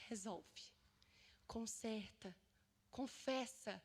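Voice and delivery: soft, low urging with pauses between words; quietly prompt, as a still inner voice; gentle, delicate voice. Retold or imitated. Holy Spirit nudging her in her inmost heart, gentle and delicate. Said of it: "é uma voz mansa e delicada".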